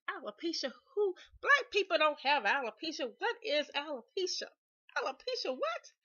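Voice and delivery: high, loud, indignant questioning; re-enacted outraged bafflement, rising to a squeal; loud incredulity. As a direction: scoff in disbelief at an absurd diagnosis. Acts out incredulous reaction to dermatologist.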